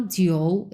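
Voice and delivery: জিও drawn out, dipping low; slow, low-dipping জিও; third tone. Shown as a demonstration. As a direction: sink slowly into জিও. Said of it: "তিন number tone".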